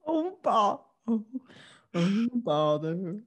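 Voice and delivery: slow, mopey donkey voice; a slow, sad Eeyore sigh; Eeyore impression. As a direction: drag out "oh, bother" like a gloomy donkey. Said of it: "that was Eeyore".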